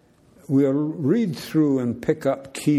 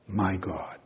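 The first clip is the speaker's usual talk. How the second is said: breathy whisper, barely voiced; whispered devotion; whispered vow; 6 semitones lower, 7 dB quieter than usual. A narrator's reading. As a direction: let the last two words fall to a hush. Ruth's vow, whispered hush.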